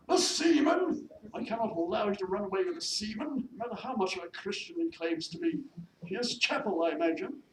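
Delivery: loud, high, disdainful lady employer; scandalised mistress. Acted. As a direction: pounce on it in haughty disapproval. Mistress scorns maid's sailor.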